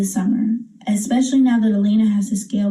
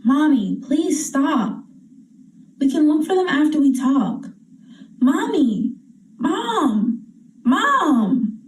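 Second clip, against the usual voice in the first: higher, insistent calls to mom; pleading for attention; 4 semitones higher, slower than usual. Acted. Child begging a busy mother to stop, pleading. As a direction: whine for mom to stop and listen.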